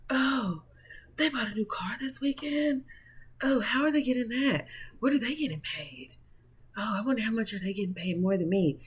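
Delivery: mock a nosy coworker murmuring behind your back; nosy coworker gossip.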